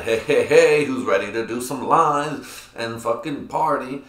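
loud, drawn-out hype-man dad; partying dad. Retold or imitated. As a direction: imitate a rowdy dad rallying the party. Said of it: "That'd be like my dad".

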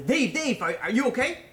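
high, loud imitation of a panicked caller; retold screaming. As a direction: act out yelling down the phone. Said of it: "he hears someone screaming".

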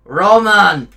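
put-on loud, high Eastern European accented call; Nico Bellic impression. Player as game hero hailing his cousin, loud Eastern European accent. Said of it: "I want you to do Nico".

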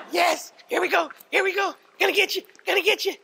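very high, loud excited dog play voice; calling from far away. Real, not acted. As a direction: squeal praise at a playful dog. Owner plays with dog, praising.

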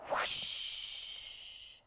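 breathy whoosh; whooshing sound. A narrator's reading. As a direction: blow it out like wind. Sliding down ice.

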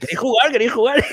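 high, loud repeated offer; imitated schoolmate. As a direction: mimic a greedy kid eagerly offering a turn. Acts greedy schoolmate offering the controller.

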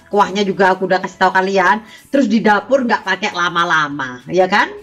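loud, bright sales pitch; excited. Real, not acted. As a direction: hype it up for viewers.

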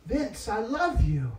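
soft, slow and tender; calm gentle voice. Retold or imitated. God speaking to the weeping preacher, soft and tender. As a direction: say his name like a gentle father. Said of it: "in the most calmest, most gentlest, sweetest way".

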